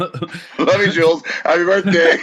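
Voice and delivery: high, far squeaky parody with a laugh; mocking squeaky echo. Mimicking Jules's goodbye in a squeaky parody voice. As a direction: parrot him in a silly high voice.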